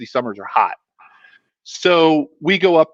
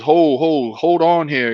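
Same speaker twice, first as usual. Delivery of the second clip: put-on North Carolina accent; 2 semitones higher, slower than usual. Coach halting his players on the path, imitated North Carolina accent. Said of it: "I'll try to emulate".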